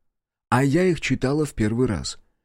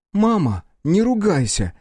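put-on indignant child; 8 semitones higher than usual. A narrator's reading. Daughter scolds her mother for swearing, indignant child. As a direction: scold mother like a little girl. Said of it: "возмутилась Вася".

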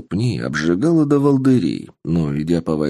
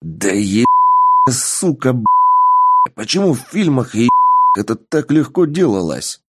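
cursing angrily; 8 semitones higher, 4 dB louder than usual. Acted. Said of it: "взорвался руганью".

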